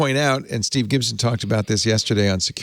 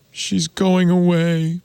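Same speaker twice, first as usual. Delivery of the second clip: mock lament; 7 semitones higher, slower than usual. Mourning the Issa Rae voice.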